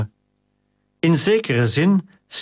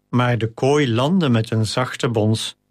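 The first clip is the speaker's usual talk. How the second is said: ordinary delivery, nothing marked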